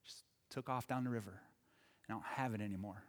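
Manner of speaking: put-on soft, low, broken-hearted boy; quietly choking back tears; a boy telling his mom the boat is gone; tearful. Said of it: "couldn't say it except through tears".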